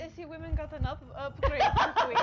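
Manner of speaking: laughing. Real, not acted. Laughing at the joke.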